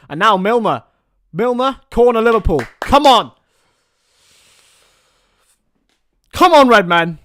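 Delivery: high, loud cheer of come on; excited urging. Real, not acted. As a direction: roar the team forward. Urging Liverpool on at a corner.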